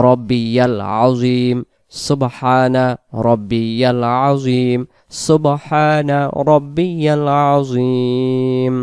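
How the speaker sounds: সুবহানা রব্বিয়াল আজিম very slow and distinct; clear slow recitation. Shown as a demonstration. Teacher showing the clear correct version, very slow. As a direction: spell it out clearly for learners. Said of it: "স্পষ্ট করে বলবো".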